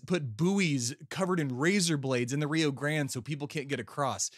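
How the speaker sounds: usual speaking voice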